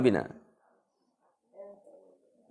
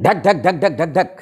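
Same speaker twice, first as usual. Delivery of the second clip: ঢাক repeated as even drumbeats; beating the rhythm; 4 semitones lower, faster than usual. Shown as a demonstration. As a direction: tap out ঢাক like a drum. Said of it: "বোঝা গেছে?". (ঢাকের তালে শব্দ)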